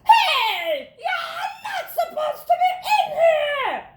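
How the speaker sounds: high, loud scolding shout; shrill lunch lady shout; lunch lady yelling. A narrator's reading. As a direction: yell at the dog to get out. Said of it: "one of the lunch ladies yelled".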